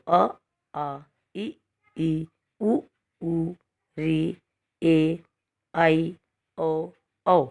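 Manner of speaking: each vowel spoken clearly one by one; vowels said aloud for practice. Shown as a demonstration. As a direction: say every vowel separately for the children to repeat. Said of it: "बोलकर देखो". (स्वरों का स्पष्ट उच्चारण)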